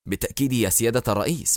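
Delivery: fast, clipped-off reassurance; quick assurance. Acted. Minister assuring the president quickly as he leaves. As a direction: rush it out while turning to leave. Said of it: "غمغم الوزير".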